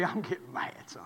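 softly whispered confession; whispered aside. Real, not acted. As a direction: lean in and whisper it. Confiding anger in whisper.

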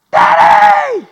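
high, loud drawn-out yell imitating daughter; mimicked child's shriek for help; daughter's holler. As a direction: holler for Dad across the house. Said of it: "she hollers".

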